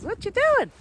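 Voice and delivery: high, loud mock-friendly question from the lady; imitating the lady. Retelling the lady asking what she's doing, high and loud. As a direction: pitch it way up like a curious passer-by.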